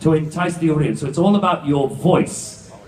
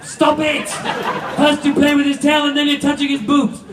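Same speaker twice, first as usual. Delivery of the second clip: high, laughing mock telling-off; host mock-scolding a contestant for touching the mascot, high pitched; 10 semitones higher than usual. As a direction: scold them like naughty kids.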